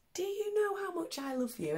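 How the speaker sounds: the little rabbit asking, pitched high and soft; small bunny voice, high up and a touch quiet. Acted. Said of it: "He says".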